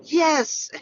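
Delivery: high-pitched mock shout of triumph; retold shout. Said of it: "I just shouted".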